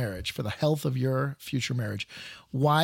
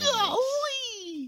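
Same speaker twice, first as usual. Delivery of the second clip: mock exclaim. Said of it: "we were like".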